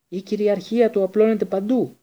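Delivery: high, loud panic; gasping. A narrator's reading. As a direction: gasp it out in fear. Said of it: "κοντονάσαινε ο Πέντιγκρου".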